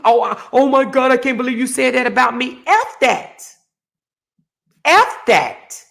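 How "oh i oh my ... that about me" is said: higher, put-on whiny victim; hammed-up wounded tone; mock victim voice. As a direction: mimic someone playing the victim. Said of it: "So the victim".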